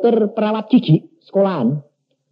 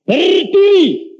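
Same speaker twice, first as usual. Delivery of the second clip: drawn-out high command, a little louder; slow, stretched order to stand; God telling a stubborn man to rise; 12 semitones higher, 4 dB louder, much slower than usual. Retold or imitated.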